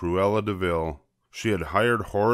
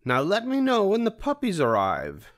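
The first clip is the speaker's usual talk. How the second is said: much higher bossy villainess voice; haughty high Cruella order; Cruella de Vil; 12 semitones higher than usual. A narrator's reading. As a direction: command it like a diva.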